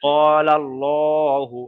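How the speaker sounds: ক্বালাল্লাহু very slow, with long, rounded "llo"; full heavy L. Shown as a demonstration. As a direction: stretch ক্বালাল্লাহু, L heavy and dark. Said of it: "এখানে বলতে হবে".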